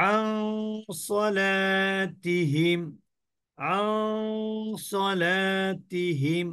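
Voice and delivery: আন turned into a long nasal hum, then slow সলাতিহিম; ghunnah demo. Shown as a demonstration. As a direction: intone সলাতিহিম slowly after a held nasal on আন. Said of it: "অনিশ্বারের মতন".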